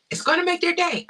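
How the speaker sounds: high, loud, delighted promise; high excited emphasis on "make their day"; excited insistence. Real, not acted.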